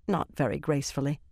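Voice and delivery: usual speaking voice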